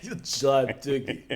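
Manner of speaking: put-on Russian accent. Echoing a friend's dated-slang joke, Russian accent. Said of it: "I would need that in a Russian accent".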